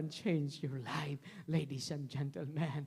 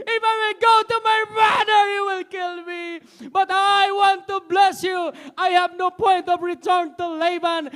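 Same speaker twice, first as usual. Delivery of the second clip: desperate shouted plea, voice near crying; cling to God and scream for the blessing; Jacob crying out; 20 dB louder than usual. Acted. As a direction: Jacob wrestling the angel, crying out. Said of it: "Jacob wrestling with God".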